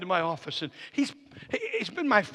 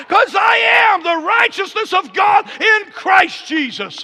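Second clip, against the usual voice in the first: high shouted declarations, slowing and stretching; full-force preaching; 13 semitones higher, 14 dB louder than usual. Real, not acted. Preacher calling the church to peace and joy, shouted preaching. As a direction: build to a loud, triumphant proclamation.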